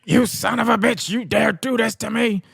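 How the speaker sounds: mock outraged Vince. Wrestling owner raging at Rock, mock outraged. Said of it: "Vince says".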